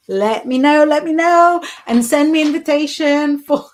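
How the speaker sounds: loud, high, eager insistence; excited pleading. Real, not acted. Reader demanding a wedding invitation, loudly excited. Playfully begging for wedding news.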